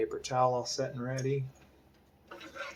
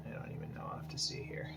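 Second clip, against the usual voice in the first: whispers to himself, unsure; a quick under-breath aside to himself; whispered aside; 10 dB quieter, faster than usual. Real, not acted. Artist checking his lines, whispering to himself. Muttering to himself while drawing.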